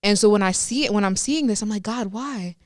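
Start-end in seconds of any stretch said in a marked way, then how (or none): none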